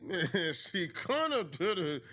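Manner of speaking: "kinda" stretched, slow and lazy; slow, drawled quote. Retold or imitated. Mocking [name]'s words in a slow drawl. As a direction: drag it out slowly, half-joking. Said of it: "[name] is saying".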